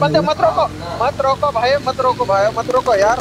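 high-pitched, insistent plea to teammates; urgent pleading. Real, not acted. Urging teammate not to stop. (घबराई हुई, ऊँची आवाज़ में मिन्नत)

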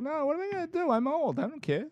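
high, frail grandma voice; old lady voice. Retold or imitated. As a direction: play a carefree old grandma. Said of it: "Your old lady voice".

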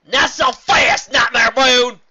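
slow, high, loud heroic call; heroic shout. Acted. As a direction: call out from the doorway. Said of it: "a call came from behind".

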